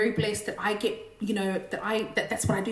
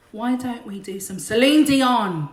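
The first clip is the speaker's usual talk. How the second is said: ordinary delivery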